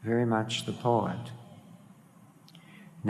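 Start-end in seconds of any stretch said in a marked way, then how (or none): none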